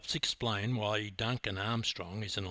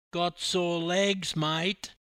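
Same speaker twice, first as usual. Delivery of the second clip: higher, drawn-out commiseration; mock sympathy; 6 semitones higher, slower than usual. Teammate commiserating with the swimmer, sympathising. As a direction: sympathise slowly, pitched up. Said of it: "sympathised Jules".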